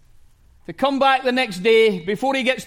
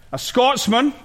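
usual speaking voice

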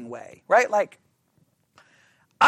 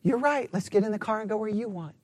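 mock calm; 8 dB quieter than usual. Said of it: "I'm so low key and calm".

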